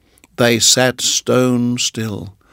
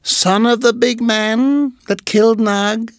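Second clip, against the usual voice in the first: high hissing snake voice; hissed; 11 semitones higher than usual. Acted. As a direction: hiss like a cobra. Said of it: "she hissed".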